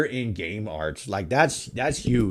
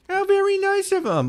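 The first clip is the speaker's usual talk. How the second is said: high mock-sweet sarcasm; sarcastic, much higher voice. Sarcastic remark about posted stream.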